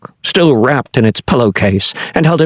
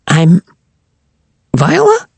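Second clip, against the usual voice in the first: high squeaky nervous voice; a jittery little squeak; 6 semitones higher than usual. A narrator's reading. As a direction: squeak it out, nerves showing. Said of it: "she said in a nervous squeak".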